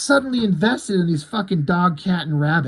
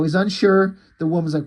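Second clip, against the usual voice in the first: usual speaking voice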